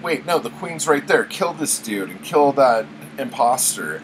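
mildly hammed-up group protest; mock crowd outcry. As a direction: play an onlooker raising the alarm. Crowd turns on the supposed imposter.

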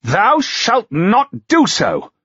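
outraged duke declaring; rising voice. A narrator's reading. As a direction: forbid it outright. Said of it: "his voice rising".

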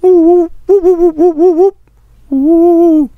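loud, high hooting whoops; repeated high slide-whistle whoops; high cartoon whoops. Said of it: "peek around the corner like".

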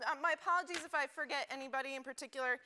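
ordinary delivery

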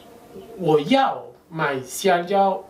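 我要买香蕉 spoken very slowly, each syllable apart; teacher slowing down for learners; slow demo read; slow model phrase. Shown as a demonstration. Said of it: "Pero si yo digo".

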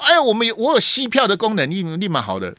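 high, smug mock boast; lightly voiced bragging politician; mocking Wang Yi-chuan. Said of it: "那个王义川有没有说".

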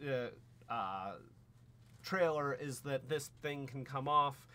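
ordinary talk

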